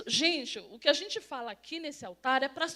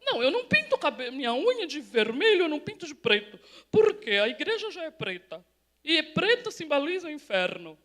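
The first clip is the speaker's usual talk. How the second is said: loud, hammed-up refusal; exaggerated imitation, played for laughs; mock scrupulous churchwoman; 9 dB louder than usual. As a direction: parody a self-righteous objection with swooping pitch. Mimics overscrupulous woman refusing nail colours.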